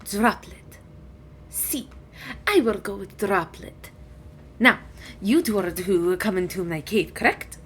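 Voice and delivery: a hint of a stately dragon, softly spoken; quietly approve, then check like a courteous dragon; dragon, satisfied. Dragon invites two into her cave, softly courteous.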